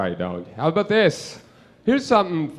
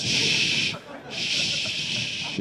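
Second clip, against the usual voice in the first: long, soft "shh"; hushing shush; 8 dB quieter than usual. Acted. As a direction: soothe with drawn-out hushes. Acts smothering the family name.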